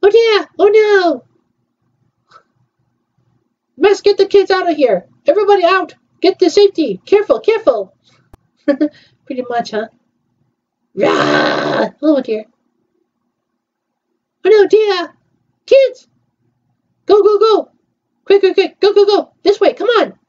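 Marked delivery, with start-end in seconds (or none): 0.0-7.9 panicked. Pretend. Panic evacuating kids, voiced. 14.4-20.2 panicked shouting. Pretend. Panic evacuating kids, voiced.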